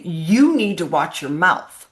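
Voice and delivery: slow parental warning, lightly acted; mildly acted telling-off, drawn out; mock scolding.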